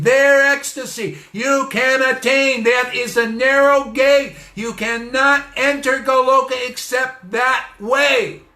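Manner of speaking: rising to a loud, high preaching climax, slowing on key words; fiery preaching. Acted. Preacher urging listeners toward the narrow gate, full-force preaching. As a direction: build the sermon up to full force.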